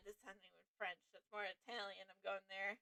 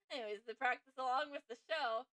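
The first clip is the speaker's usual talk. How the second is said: ordinary talk, nothing marked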